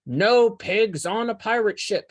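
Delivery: gruff pirate captain refusal. A narrator's reading. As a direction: lay down the law like a pirate captain.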